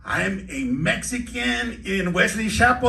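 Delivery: loud, high, slow proclamation; mock-dramatic confession. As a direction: shout out your big secret. Big mock confession after quiet build-up.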